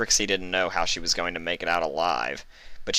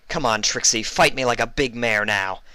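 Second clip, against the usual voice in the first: louder, taunting call across the room; 5 semitones higher, 5 dB louder than usual. A narrator's reading. Vinyl goading Trixie to come fight, taunting call. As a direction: goad her out from behind the desk. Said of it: "she called out".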